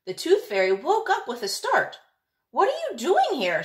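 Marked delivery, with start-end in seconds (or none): 2.5-3.6 high, raised call after a sudden waking; startled call, pitched up. Acted. Tooth Fairy calling after the seagull: high startled call. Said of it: "she called out".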